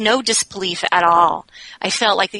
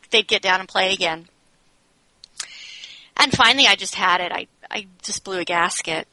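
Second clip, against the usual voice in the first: ordinary talk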